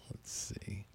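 real under-the-breath whisper to himself. Real, not acted. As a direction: breathe it out barely audible, talking to yourself. DJ mutters to himself off-mic.

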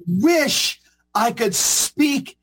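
very slow, weighty retelling; imitating a moved bishop, slow and deliberate; moved, humble admission. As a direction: draw out each word with heavy emphasis. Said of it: "tears started coming down his eyes".

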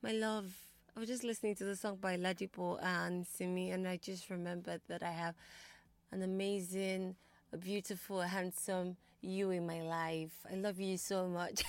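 soft, intimate pretend phone declaration; hushed, mock-romantic sweet talk; sweet lover's call. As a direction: play-act murmuring tenderly to a lover, savouring the words. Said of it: "put a call across and say".

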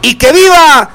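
high, long drawn-out cheers; stretched festive cries, falling at the end; festive viva shouts. Acted. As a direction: call out toasts like a fiesta announcer. Radio host calling out vivas.

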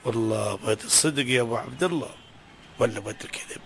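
low, soft complaint of hardship; a tired man pouring out his troubles; weary lament. Acted. Father confessing money troubles to his friend, low weary lament.

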